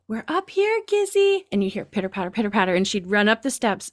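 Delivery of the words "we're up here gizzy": much higher sing-song call; cooing up to a pet; calling to the cat. Retold or imitated. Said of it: "And then we'd go".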